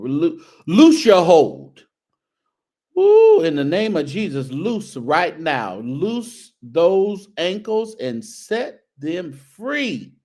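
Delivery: slow, loud preacher's command, hammed up; mock preacher laying hands, slow and booming; mock deliverance preaching. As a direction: cast out the spirit from those ankles, jokingly. Mock preaching deliverance over ankles.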